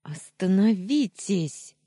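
high, loud, drawn-out cry; panicked yell. A narrator's reading. Heroine rushing to the fighting men, panicked yell. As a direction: cry out to halt them. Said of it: "завопила я".

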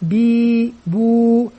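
با بي بو each vowel drawn out slowly; slow syllable demo. Shown as a demonstration. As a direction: stretch با بي بو for a small child. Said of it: "يتمكن من الصوت الصحيح". (نطق با بي بو ببطء شديد)

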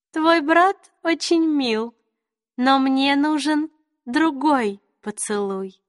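high, slow, teasing queen; high regal faerie queen. A narrator's reading. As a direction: sweetly toy with him. Queen voiced in the story.

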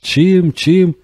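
high, disbelieving echo question; incredulous query. A narrator's reading. Colleague asks the doctor to repeat himself, incredulous. Colleague cannot believe what he heard.

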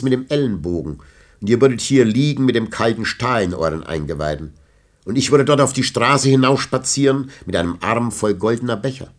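lightly voiced heated vagabond; a touch of a bitter poet's defiance; proud thief Villon. A narrator's reading. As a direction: Villon hitting back at the old knight. Villon defends his honour.

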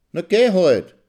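loud and high, dismissive; scornful retort. Acted. Wife telling husband to go, scornful shout. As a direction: brush him off with a shout.

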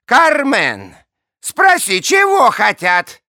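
high, loud call across the hall. Acted. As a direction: shout for the servant. King calls out to Carmen.

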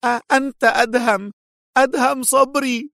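sudden recognition, alarmed; exclaiming in shock. A narrator's reading. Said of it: "هتف إيتان".

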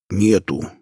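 plain reading voice

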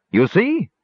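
high, indignant exclamation; self-righteous. Acted. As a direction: crow as if proven right. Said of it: "exclaimed self-righteously".

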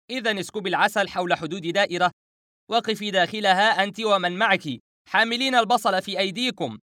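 high, urgent barrage of instructions; frantic shouting. Acted. Narrator directing Howaida into the circle, frantic. Frantic orders over the phone.

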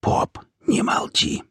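slow, urgent whisper; voiceless, drawn-out urging. A narrator's reading. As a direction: whisper it as a hushed prompt. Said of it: "прошептал Альт".

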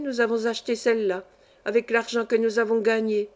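plain reading voice

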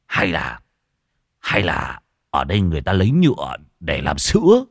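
low-pitched musing with pauses; pondering dimwit. Acted. Gang member musing on cactus farming, low and pondering. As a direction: puzzle it out in a low voice.

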